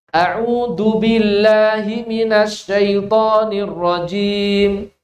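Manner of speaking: "A'udhu billahi minasyaitonir rajim" chanted slowly with the wrong dal sound; wrong letter recitation. Shown as a demonstration. Teacher imitating a reading with the wrong letter. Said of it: "seperti ini bacanya".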